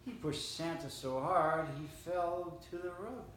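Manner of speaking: usual speaking voice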